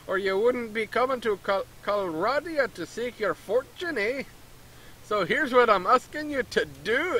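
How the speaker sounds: hammed-up Canadian merchant tacking on eh; a merchant asking a stranger for help in a mock Canadian accent. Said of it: "It's obviously Canadian".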